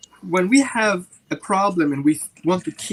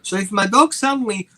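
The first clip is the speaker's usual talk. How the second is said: normal talking voice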